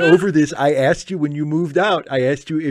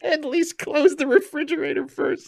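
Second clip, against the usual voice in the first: much higher squealing through laughter; laughing squeal. Acted. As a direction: squeal it, barely holding it.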